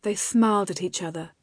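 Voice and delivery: ordinary delivery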